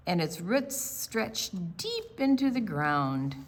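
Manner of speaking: lively storybook read-aloud; picture book read to a group of children. Acted. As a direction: read it like storytime for little kids.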